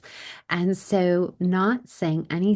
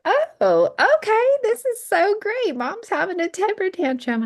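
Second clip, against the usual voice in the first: put-on much higher, louder, sing-song kid voice; cheery daughter; 6 dB louder than usual. Daughter cheerfully noting mom's tantrum, high bright kid voice. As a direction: chirp it like a delighted little girl. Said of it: "And she was like".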